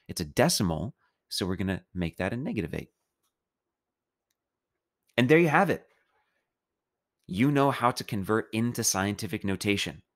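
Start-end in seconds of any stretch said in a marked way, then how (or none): 5.2-5.8 cheerful announcement. Real, not acted.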